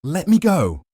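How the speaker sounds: high, loud struggling yell; furious yell to be let go. Acted. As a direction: scream it while fighting free.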